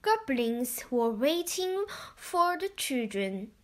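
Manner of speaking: ordinary delivery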